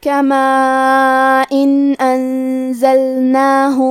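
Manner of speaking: کما ان انزلناہ recited very slowly, the نون of ان read clearly with no nasal hum; slow tajweed recitation. Shown as a demonstration. Teacher demonstrating to students, recites ان clearly with no nasal hum. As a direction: chant ان plainly without ghunnah, slow and measured. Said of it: "بغیر غنہ سے یہ حکم کو ادا کریں".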